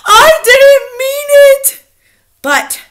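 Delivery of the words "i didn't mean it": slow, very high, loud plea; crying out miserably. A narrator's reading. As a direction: wail the excuse, stretched and high. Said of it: "cried the miserable culprit".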